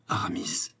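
very soft, whispered murmur. A narrator's reading. D'Artagnan recognising Aramis, murmuring. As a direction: breathe the name almost without voice. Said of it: "murmura D'Artagnan".

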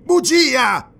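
high, loud cry of accusation; explosive, high-pitched denial; indignant outburst. A narrator's reading. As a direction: burst out, voice leaping up. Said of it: "proruppe Arus".

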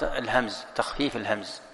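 normal talking voice

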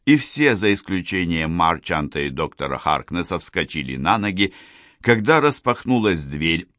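ordinary delivery, nothing marked

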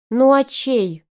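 high, loud scornful scoff; scoffing snort. A narrator's reading. Arina scoffing at his doubt, snorting. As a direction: toss it off with a contemptuous snort. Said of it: "фыркнула Арина".

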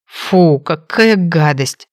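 slow, mumbled disgust; mumbling. A narrator's reading. Wounded brother swallowing medicine, slowly mumbling in disgust. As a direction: mumble it through the bitter medicine. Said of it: "промямлил Фрэнк".

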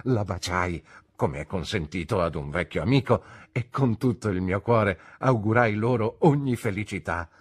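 ordinary delivery

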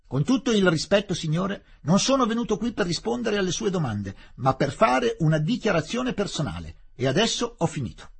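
loud, high, clipped-off formal reply; politely but forcefully shut him down; Fielding standing his ground before the collector; firm refusal. Acted.

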